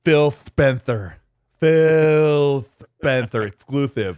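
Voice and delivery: "Phil Spencer exclusive" with every s turned to th; exaggerated lisp. Host reading Valerian's chat, slow mock lisp on "Spencer". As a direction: draw out "Phil Spencer" very slowly with a thick th lisp.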